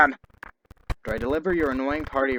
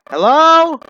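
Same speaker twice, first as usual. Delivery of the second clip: higher, louder second call ending down; 12 semitones higher, 13 dB louder than usual. Acted. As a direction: call again, more urgent. Calling again, louder.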